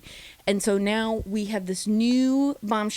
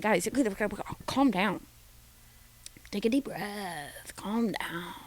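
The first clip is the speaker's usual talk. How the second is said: softer, slow calm-down voice; mock calming; 5 dB quieter than usual. Telling the excited boys in the villa to calm down, mock soothing. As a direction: soothe overexcited boys, half-joking. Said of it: "Honestly, I was like".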